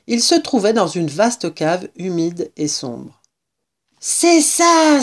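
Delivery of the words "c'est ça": high, triumphant cry; exclaiming. A narrator's reading. Mister Fox finding the cellar, excited cry. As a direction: call it out higher, with satisfaction. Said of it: "s'écria maître Renard".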